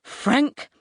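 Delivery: high, sharp call of his name; angry yell. A narrator's reading. Mum yelling at her son, angry. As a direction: call him to order crossly. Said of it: "she yells".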